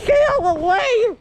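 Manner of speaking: yelling high and loud; shouting. Real, not acted. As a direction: yell to clear the way. Shouting at someone in snow.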